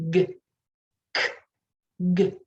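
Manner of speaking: g 는 유성, k 는 무성으로 번갈아; 목 뒤에서 g k g 를 또렷이. Shown as a demonstration. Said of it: "turn your voice box on".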